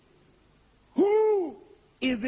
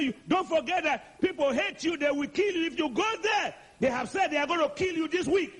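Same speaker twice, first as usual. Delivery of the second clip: higher, urgent warning; alarmed disciples; 7 semitones higher than usual. Acted. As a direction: plead with him not to go. Disciples warning Jesus, voiced.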